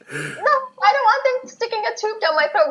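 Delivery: squeaky, high refusal; high-pitched protest. Retold or imitated. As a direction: pitch it way up, pushing back. Quoting her own refusal of a scope.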